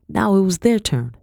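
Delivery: ordinary delivery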